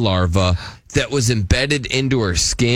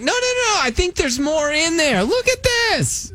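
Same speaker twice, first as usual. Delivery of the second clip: very high mock child voice, insistent; eager kid. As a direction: imitate a helpful kid piping up in falsetto. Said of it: "your kid is like".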